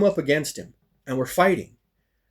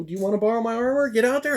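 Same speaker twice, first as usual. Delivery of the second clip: friendly offer; 9 semitones higher, faster than usual. Retold or imitated. Friend's offer, voiced high and quick.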